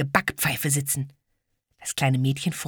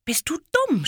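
shrieked; 14 semitones higher than usual. Acted. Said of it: "schrie das kleine Mädchen".

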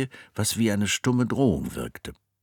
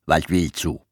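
gruff nurse; 2 semitones lower, 4 dB louder than usual. Acted. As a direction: bark it like a rough nurse. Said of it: "raunzte Schwester Iris".

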